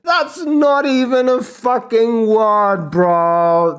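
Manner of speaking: high, slow disbelieving yell; can't believe what he just heard; incredulous outburst. Real, not acted. Streamer to chat, incredulous high yell. Outraged at a weird pronunciation.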